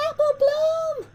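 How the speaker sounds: high, loud piercing scream. A narrator's reading. Corn doll screaming at Apple Bloom, high loud scream. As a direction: shriek the name in terror. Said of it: "screamed at her".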